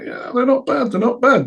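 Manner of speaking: high-pitched hesitant appraisal; doubtful, reassuring mock squeak; noncommittal approval. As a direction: hedge it in a high voice. Rick hesitantly judging the miniatures.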